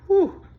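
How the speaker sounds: sharp high exhale, overwhelmed; emotional high whew. Real, not acted. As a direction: blow out a high breath to steady himself.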